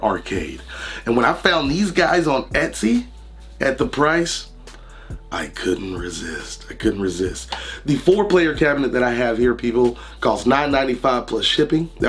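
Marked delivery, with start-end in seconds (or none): 5.3-6.7 low, soft slow aside; hushed confession. Acted. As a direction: drop it low and quiet like a confession. Repeat dropped low.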